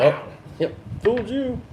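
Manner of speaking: mock taunt.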